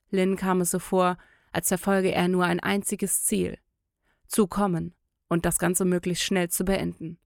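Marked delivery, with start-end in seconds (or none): none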